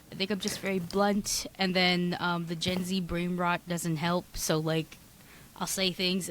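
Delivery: ordinary delivery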